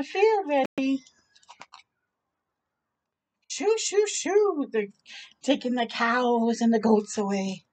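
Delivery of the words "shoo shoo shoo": high, playful shoo; shooing animals. Acted. Kids shoo cows and goats off field, playful. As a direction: chase the goats off.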